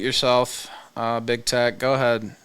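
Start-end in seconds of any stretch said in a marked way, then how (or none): none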